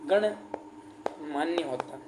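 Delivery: गणमान्य stretched syllable by syllable, soft and low; very slow dictation. Shown as a demonstration. Teacher dictating गणमान्य very slowly and softly to students. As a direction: draw out गणमान्य very slowly so students can write it. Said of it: "फिर भी गलत लिख दिया मैंने".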